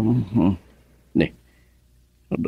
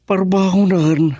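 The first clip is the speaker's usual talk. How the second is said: shocked; 10 semitones higher than usual. Acted. Father shocked at late hour.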